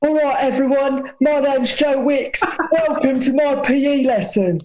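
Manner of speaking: chirpy aerobics instructor hyping the class; Joe Wicks impression. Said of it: "I bet [name] can do an impression of Joe Wicks".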